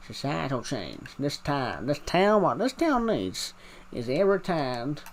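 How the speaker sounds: hammed-up Texan speechifying; rally the town in a Texan accent; put-on Texan twang with swooping pitch. Shown as a demonstration. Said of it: "I can do a Texan accent".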